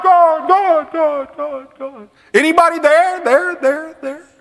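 high anguished calls to God, fading; desperate calling out. Acted. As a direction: cry into the void, then search for an answer. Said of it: "I'm crying out to God".

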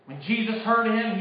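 ordinary delivery

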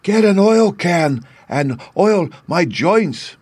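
slow, high, plaintive plea; sad Tin Man. A narrator's reading. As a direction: ask slowly in a loud, high, sorrowful voice. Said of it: "the sad voice in which the man spoke".

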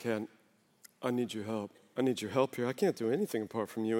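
hushed prayer of need; humble plea. Retold or imitated. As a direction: tug gently on a sleeve asking for help. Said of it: "be like".

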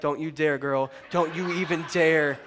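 low, comic threat; playful low-voiced husband's warning; mock warning. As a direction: warn her off the last bite, voice dropped. Husband silently warns his wife.

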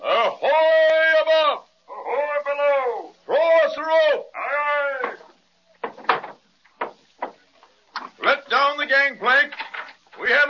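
high, slow shipboard call; pirate mate shouting up to his ship; hailing. Acted. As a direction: call up to the deck.

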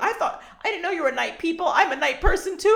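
high, put-on chirpy neighbor voice; mocking the neighbor. Mocking Mrs. Weedlemeyer at four a.m., high put-on voice. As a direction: parody a weird neighbor's gushing. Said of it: "She's like".

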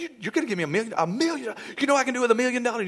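rattled off fast and high; fast excited talking. Acted. Congregant to pastor over a million, talking fast. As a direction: gabble excitedly at high speed. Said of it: "really fast talking thing".

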